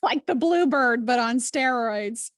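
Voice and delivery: slow, laughing, high words. Real, not acted. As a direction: say it through a laugh. Laughing after the story.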